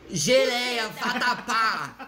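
drawn-out mock retort, laughing; mock Brazilian comeback. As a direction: spit out food names like curses, slowly. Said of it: "olhar pra eles e falar assim". (retruque zombeteiro e arrastado)

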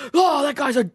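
high, exasperated devil voice. Acted. Devil noticing who got into heaven, exasperated. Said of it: "guys slipped through the devil's fingers".

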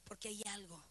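soft, low, reflective sermon voice; hushed preaching. Real, not acted. Preacher on waiting outside for God's answer, hushed and low.

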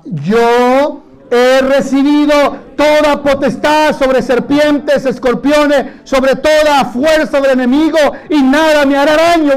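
drawn-out, emphatic recitation of "Yo he recibido"; slow preached affirmation of "Yo he recibido"; slow emphatic declaration. Shown as a demonstration. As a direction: lead the congregation word by word in "Yo he recibido". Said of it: "usted dice conmigo esta palabra".

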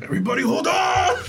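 high laughing rally cry; heroic shout. Pretend. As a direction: yell it like a cornered hero. Acting hero shout.

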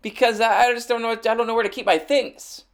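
high, whining mock lament; whiny complaint. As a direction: whine it like a helpless man-child.

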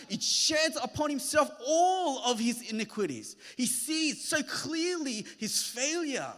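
high, loud, intense preaching; preaching at full force. Acted. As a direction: drive the climax home from the pulpit. Preaching the climax.

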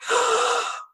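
sharp, very high gasp of shock; big gasp. Retold or imitated. Stylist reacting to Bud's weight loss, sharp high gasp.

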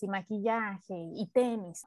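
ordinary talk, nothing marked

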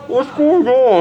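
high-pitched quote of a baffled woman; mock bewildered woman. Storyteller voicing the high-fived woman, mock bewildered.

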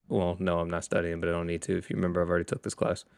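low, sullen answers; sulky kid. Retold or imitated. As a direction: mumble back to mom. Son answers mom low.

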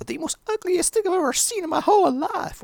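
high-pitched bratty American girl impression; American accent. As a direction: put on an exaggerated, high American little-girl voice. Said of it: "What the hell do I give a American accent for?".

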